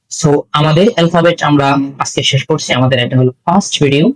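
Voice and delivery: ordinary talk, nothing marked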